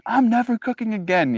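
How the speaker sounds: a high, dramatic cry of never cooking again; mock huffy vow; mock outburst. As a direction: toss it off as an overdramatic vow. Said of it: "inner monologue outbursts".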